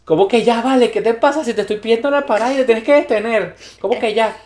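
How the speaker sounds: indignant passenger scolding the driver; mock angry rant. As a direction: Venezuelan outrage at the driver's ya. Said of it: "Y yo".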